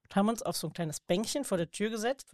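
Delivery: ordinary talk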